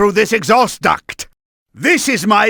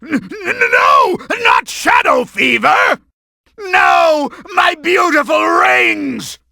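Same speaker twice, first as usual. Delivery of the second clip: stammering villain yelling in dismay; dismayed wail; 7 semitones higher than usual. Acted. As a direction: wail over the lost treasure. Boss losing his rings.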